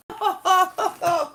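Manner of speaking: loud burst of worked-up laughter; excited laugh. Real, not acted. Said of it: "getting a bit excited there".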